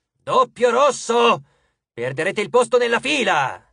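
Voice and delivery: high, loud impatient summons; calling out. Acted. Titan warning the queue they will lose their place, calling out. Crio calls out to the horde.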